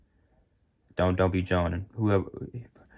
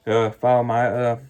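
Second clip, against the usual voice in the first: normal talking voice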